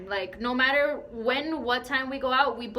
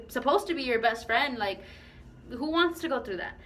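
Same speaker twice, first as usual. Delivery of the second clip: usual speaking voice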